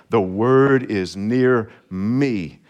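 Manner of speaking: slow, deliberate "The word is near me"; slow personal affirmation. Shown as a demonstration. Pastor modelling the personal confession, spoken slowly. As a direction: let each word land, slowly. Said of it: "We'll make it personal".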